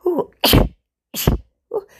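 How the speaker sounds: high, loud jittery ooh; mock nervous ooh. Shown as a demonstration. Acting out being on edge for the listener, high nervous ooh. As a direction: squeak like someone on eggshells. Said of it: "they want you to be".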